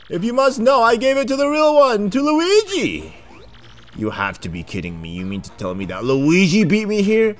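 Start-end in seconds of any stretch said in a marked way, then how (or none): 0.1-3.1 high, loud pompous sleuth voice; high theatrical detective accusing the thieves. Acted. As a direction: point the finger like a proud detective. Detective reveals with flourish.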